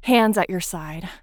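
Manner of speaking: loud, firm order; firm loud command. Acted. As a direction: bark it out as an order. Giving orders in roleplay.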